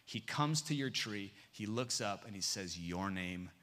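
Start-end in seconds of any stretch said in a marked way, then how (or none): 2.8-3.5 soft, low, loving hush; said with love. Shown as a demonstration. Pastor voicing Jesus calling a name with love. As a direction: hold it gently like a gift. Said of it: "with love".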